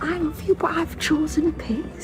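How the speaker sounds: whisper. Pretend. Jokey quote, whispered.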